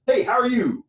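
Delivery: high, loud pitchman hey how are you; announcer hype. Shown as a demonstration. Coach showing the greeting that sounds like an ad, hyped. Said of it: "'Cause that's like, "Call one eight hundred".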